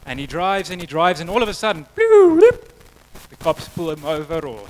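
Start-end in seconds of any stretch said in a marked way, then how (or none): 2.0-2.7 high, loud siren bloops; imitated police siren. Cops suddenly pulling the driver over, high loud siren noise.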